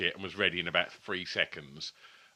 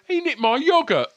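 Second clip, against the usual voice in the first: very high, loud aggrieved whine; whiny complaint; 10 dB louder than usual. Retold or imitated. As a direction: whine like a wronged child. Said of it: "apparently Alan went".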